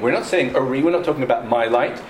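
normal talking voice

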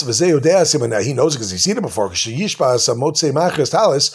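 ordinary talk, nothing marked

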